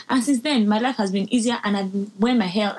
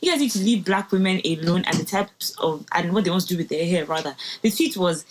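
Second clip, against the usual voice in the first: normal talking voice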